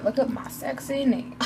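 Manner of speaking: normal talking voice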